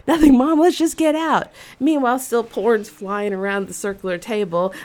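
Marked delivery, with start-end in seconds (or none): 0.1-1.5 put-on embarrassed kid. Kid brushing off mom.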